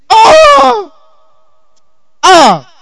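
high, drawn-out mock wail; acted crying wail. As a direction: wail like a tearful testifier, putting it on. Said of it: "And she's crying".

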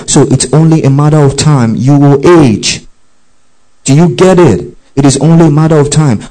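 fervent pulpit preaching; preaching at full force. Acted. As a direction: press the warning home to the congregation.

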